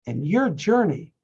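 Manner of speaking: usual speaking voice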